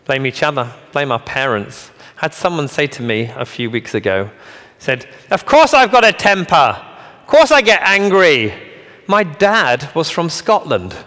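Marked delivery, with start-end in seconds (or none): 5.3-11.0 mock-indignant quote, pitched high and loud; indignant retort. Man excusing his temper to the speaker, mock-indignant. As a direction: protest loudly, imitating someone defending their temper. Quoting someone's defence of their temper.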